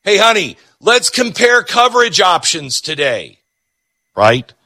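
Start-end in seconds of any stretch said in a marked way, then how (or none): 0.0-3.4 higher, sing-song cheery voice; mock eager spouse. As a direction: overdo the excitement as if it were fun. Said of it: "nobody wakes up on a Saturday morning thinking".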